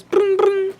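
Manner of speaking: high mock ringing; a lightly voiced phone ring; imitated phone ring. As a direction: sing out the car's ringtone. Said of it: "so it goes".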